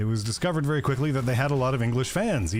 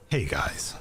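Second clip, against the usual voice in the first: put-on soft accented greeting; 9 semitones lower than usual. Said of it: "It's how they get the English people's attention".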